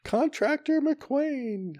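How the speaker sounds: very high, slow crier announcement; town crier. Retold or imitated. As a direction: cry the name out like a herald. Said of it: "it's like an old eighteenth century".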